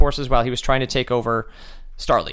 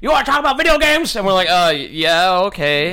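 mock homeless guy; 3 semitones higher, 3 dB louder than usual. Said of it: "homeless guy yelling at".

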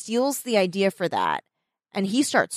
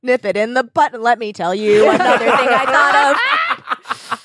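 mocking a misused phrase; mock coworker; 4 semitones higher, 5 dB louder than usual. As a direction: deliver it like a pompous speech. Imitating coworker's malapropism.

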